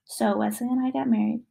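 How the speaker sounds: usual speaking voice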